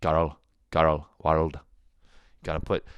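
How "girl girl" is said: put-on girl with a rolled r, Scottish style. Shown as a demonstration. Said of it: "how to say the word girl".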